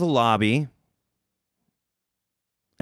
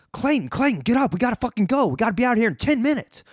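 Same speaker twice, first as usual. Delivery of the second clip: higher, urgent friend rushing him awake; panicked wake-up; 9 semitones higher, faster than usual. Retold or imitated. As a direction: shake him up, no time left.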